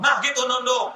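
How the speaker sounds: mocking scold. Husband scolds bowing wife.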